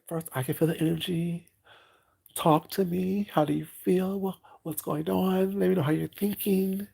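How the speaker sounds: calm the angry client gently; calm realtor. Retold or imitated. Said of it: "speak very diligently and very calmly".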